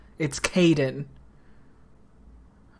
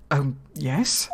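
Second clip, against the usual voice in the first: normal talking voice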